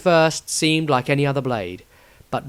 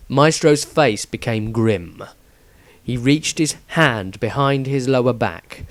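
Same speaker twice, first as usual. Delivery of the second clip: usual speaking voice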